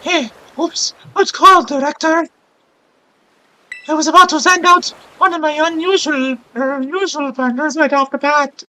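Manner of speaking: put-on high-pitched girlish character voice; accented girl character. Streamer voicing a game character, high accented girl voice. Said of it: "when I'm trying to do an accent".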